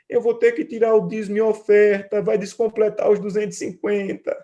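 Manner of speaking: put-on high, loud whimpering little-boy voice; imitating a crying child. Father imitates his son crying about tithe and offering. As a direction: whine it like a sobbing kid. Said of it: "E chorava".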